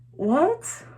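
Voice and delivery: sharply high, baffled what; incredulous what. Real, not acted. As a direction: squeak it out in total disbelief. Incredulous at flamethrower with no kills.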